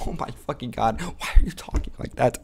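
unvoiced whisper; whispered aside. Real, not acted. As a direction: breathe it out in disbelief. Mocking the bio reading.

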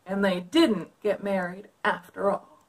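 put-on lively read-aloud for kids; story narration. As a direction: read it like a picture book. Reads picture book aloud.

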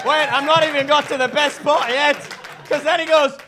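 usual speaking voice

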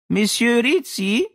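put-on high, slow girlish call. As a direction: call his name brightly through the door. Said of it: "rief die Stimme".

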